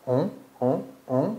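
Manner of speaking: "On" said through the nose; nasal vowel. Shown as a demonstration. Teacher showing students the French nasal vowel, nasal "on". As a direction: repeat "on" with a nasal vowel, no n at the end. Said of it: "تو بینی".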